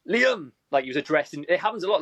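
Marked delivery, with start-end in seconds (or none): 0.1-0.5 high, mock hail to someone; calling out. Club rep stopping a lookalike, calling out. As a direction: call the name out high, like spotting someone.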